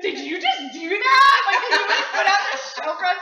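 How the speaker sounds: high, loud comic disbelief; hammed-up outraged astonishment; incredulous. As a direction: act out being appalled, half laughing. Acts out disbelief at the psychic.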